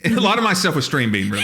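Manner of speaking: ordinary delivery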